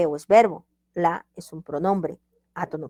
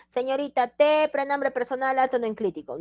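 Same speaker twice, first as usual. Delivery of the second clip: high-pitched mock pupil; acted student voice; student piping up; 6 semitones higher than usual. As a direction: pitch it up like a student offering an answer. Voices student objecting to the teacher.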